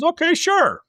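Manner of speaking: high-pitched, agreeable mock reply; chirpy imitated okay; retold eager agreement. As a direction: play a trainee readily agreeing, voice lifted high. Retold naive trainee agrees to task.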